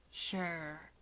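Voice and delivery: soft, doubtful sure; unconvinced. Acted. Tomura answering Natsuo's friendship claim, softly unconvinced. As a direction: let the word sag with doubt. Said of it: "clearly unconvinced".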